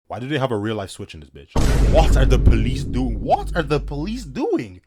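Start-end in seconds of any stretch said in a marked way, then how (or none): 1.9-3.2 loud exasperated demand; outraged yell. Pretend. Narrator reacting to real-life danger, loud outrage. 3.2-4.8 slow, high-pitched incredulous question; exasperated disbelief. Pretend. Narrator repeats his question, slow high exasperation. Outraged reaction, repeated slower.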